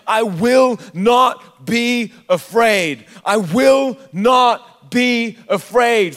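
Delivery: WILL, NOT, BE, AFRAID each punched slowly and loudly; emphatic declaration. Real, not acted. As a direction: declare it word by word, very slow and forceful. Repeats the verse as personal declaration.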